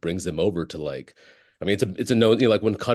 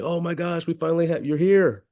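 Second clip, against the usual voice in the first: excited; 10 semitones higher than usual. Retold or imitated. Fans greeting the comic excitedly.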